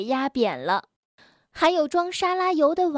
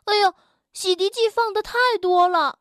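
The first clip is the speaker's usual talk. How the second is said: dismayed character; 6 semitones higher, faster than usual. A narrator's reading. Tiaotiao sees bubbles all over the floor, dismayed. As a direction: cry out at the mess. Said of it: "跳跳看着满地的泡泡说".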